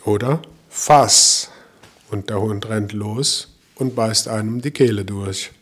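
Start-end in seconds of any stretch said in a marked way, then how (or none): 0.7-1.5 lauter scharfer Befehl; Hundekommando. Retold or imitated. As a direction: zum Angriff befehlen. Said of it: "dann ist das ein Kommando". (laut befohlen)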